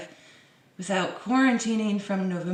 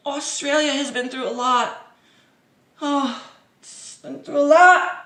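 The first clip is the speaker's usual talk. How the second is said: higher, louder hammed-up lament; mock Morrison quote; 6 semitones higher, 6 dB louder than usual. Vlogger mocking Scott Morrison's speech, hammed-up lament. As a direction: overplay the sympathy.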